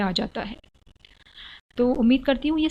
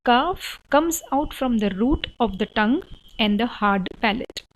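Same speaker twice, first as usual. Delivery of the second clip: slow reading; much slower than usual. Shown as a demonstration. Teacher reading the Kaaf rule out slowly. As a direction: rule read slowly word by word. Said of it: "पहले मैं English में पढ़ाती हूँ".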